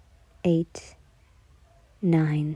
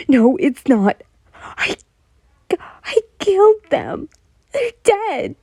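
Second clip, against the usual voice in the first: high, loud anguished yell; 10 semitones higher, 7 dB louder, slower than usual. A narrator's reading. Yen yelling to Todoroki about her dead friends, anguished. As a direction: scream it out in despair. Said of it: "she yelled".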